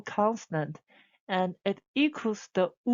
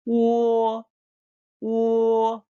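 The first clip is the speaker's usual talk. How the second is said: "wo" held high, loud and level; first tone; 4 semitones higher, 6 dB louder than usual. Shown as a demonstration. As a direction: hold a long, even, high "wo". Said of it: "so the first tone".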